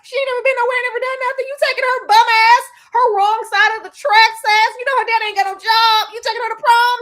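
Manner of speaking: high, loud mocking rich-kid voice; mocking snob. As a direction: sneer at her being poor. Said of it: "James Spader told your ass".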